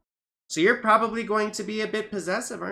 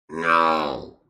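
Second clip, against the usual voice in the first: loud, very low growled shout; deep, exasperated roar; frustrated growl; 14 semitones lower, 6 dB louder than usual. Real, not acted. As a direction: growl it out from the bottom of your range. Second frustrated outburst, growled.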